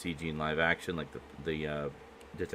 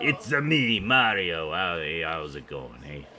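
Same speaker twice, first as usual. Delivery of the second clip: Mario impression; 4 dB louder, slower than usual. Riffing on Mario catchphrase.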